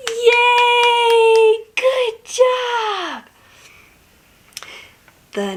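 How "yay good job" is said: high-pitched drawn-out praise; bright sing-out of celebration, high; excited cheer. Pretend. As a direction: cheer on a small child. Cheering child after barn task.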